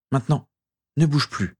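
authoritative instruction; with authority. A narrator's reading. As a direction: give the order with mild urgency. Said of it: "dit Régulus avec autorité".

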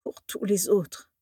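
slow, small, quavering echo; tiny quavering voice. A narrator's reading. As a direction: repeat it weakly, voice trembling. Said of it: "chevrota Slughorn d'une toute petite voix". (toute petite voix chevrotante)

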